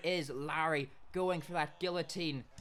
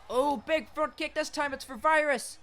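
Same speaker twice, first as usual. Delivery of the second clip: high, loud play-by-play yell; excited shout; 11 semitones higher, 5 dB louder than usual. Real, not acted. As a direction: call the hit with a burst of excitement. Excited fight commentary.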